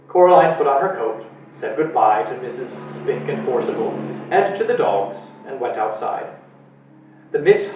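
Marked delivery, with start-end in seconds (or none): none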